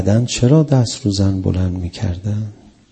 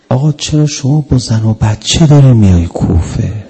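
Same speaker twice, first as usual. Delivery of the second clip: louder pleading cry; 2 semitones higher, 9 dB louder than usual. Retold or imitated. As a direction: cry out the question in a worried plea.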